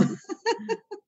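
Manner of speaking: short high burst of laughter; a bright laugh at her own joke; amused laugh. Real, not acted. Panelist laughing at her colombianómetro joke, high and light.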